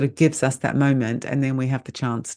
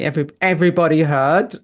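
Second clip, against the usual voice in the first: teasing; 5 semitones higher, 7 dB louder than usual. Pretend. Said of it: "tease Sona".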